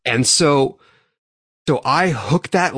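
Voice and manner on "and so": ordinary talk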